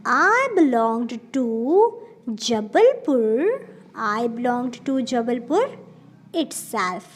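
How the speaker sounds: very slow, repeated example; slow model sentence. Shown as a demonstration. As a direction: say it slowly for students to repeat. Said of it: "तो आपको use करना होगा". (धीमा दोहराया उदाहरण)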